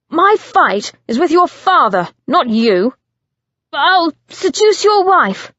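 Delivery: high, loud, goading voice; cruel taunt. Acted. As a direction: goad him into the fight.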